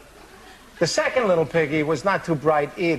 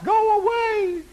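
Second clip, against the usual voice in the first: tiny, high, teary pig voice, slowed; scared little pig pleading; high whimpering pig; slower than usual. Acted. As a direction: shoo the wolf off half crying. Pig shoos wolf away.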